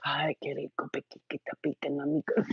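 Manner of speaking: soft unvoiced whisper. Dominican lovers in bed, soft whisper. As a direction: breathe it like bedroom talk. Quoting lovers' bedroom talk.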